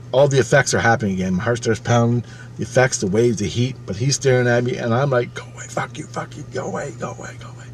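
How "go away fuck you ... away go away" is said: urgent repeated whisper; hushed imitation of panicked shouting; whispered mock yell. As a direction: plead and curse with no voice, only breath. Acting out his frightened yelling.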